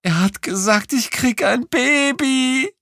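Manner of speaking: very high, loud shrill female voice; shrieking woman on phone. Acted. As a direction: screech it like an excited woman on the line. Kreischende Anruferin verkündet Baby. (kreischende Frauenstimme am Telefon)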